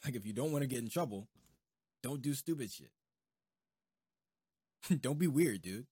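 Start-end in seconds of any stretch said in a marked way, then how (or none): none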